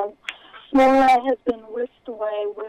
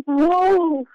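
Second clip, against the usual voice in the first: higher, loud startled yelp; startled cry; 8 semitones higher, 7 dB louder than usual. Acted. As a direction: jump back in shock. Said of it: "She sucked her breath and drew back".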